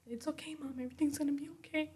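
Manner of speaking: put-on hushed, quick and high, like a child in her ear; soft whisper in ear. Daughter hugging her mom whispers, soft high whisper. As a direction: breathe it softly into mom's ear. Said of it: "she tells me in my ear".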